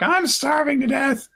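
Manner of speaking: put-on very high complaining kid whine; child complaining about the fast, very high; whiny child. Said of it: "Lynn was like".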